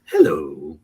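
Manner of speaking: creepy, loud clown greeting; Pennywise. Retold or imitated. Cosplayer greeting passers-by in the park in Pennywise's voice. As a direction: greet them as a sinister clown. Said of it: "like I was Pennywise".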